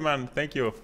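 ordinary delivery

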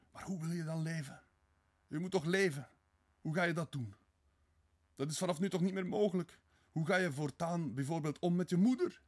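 high, pressing, distressed questions; frantic worry. Acted. Sonja fears for his life and family, frantic worry. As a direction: plead with him, alarmed for his future.

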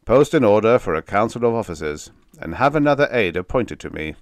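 loud, brisk order; abrupt command. Acted. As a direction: snap back into command. General abruptly snapping into action.

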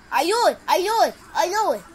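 excited recognition. Real, not acted. Recognizing a game, hyped.

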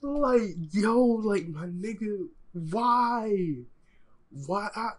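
high, loud, drawn-out protest; exasperated disbelief. Real, not acted. Viewer protesting to the man in the clip, exasperated disbelief.

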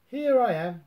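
high, loud little squeak; Roo squeaking. A narrator's reading. Roo crawls out of the log squeaking. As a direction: pop out squeaking like a tiny kangaroo. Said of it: "Roo squeaked".

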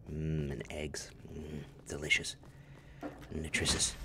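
soft whispered mmm of relish; savoring whisper. Pretend. Gamer whispering about his breakfast burritos. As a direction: whisper it, tasting the memory.

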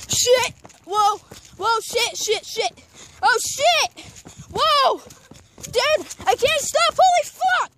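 high, loud panicked yells; screaming while falling. Real, not acted. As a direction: yell as your legs run away from you. Running downhill, can't stop.

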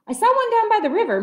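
quick, high and eager; excited high voice. Acted. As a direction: blurt it out eagerly. Excited goat voice.

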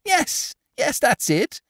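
snatch at the name gladly; eager gossip. Acted. Pundor eagerly agrees.

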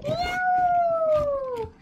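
drawn-out high whoop; long excited cry. Real, not acted. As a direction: stretch out a happy yell.

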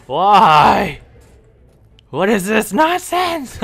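loud, high-pitched outburst; exasperated shout. Real, not acted. Gamer protesting at the game, exasperated shout. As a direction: yell in comic disbelief, voice leaping up.

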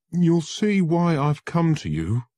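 high, loud, anguished; desperate lawyer begging Holmes for help; desperate plea. Acted. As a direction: plead for help, panicking. Visitor with shaking hands.

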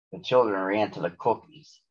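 ordinary delivery

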